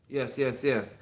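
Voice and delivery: ordinary talk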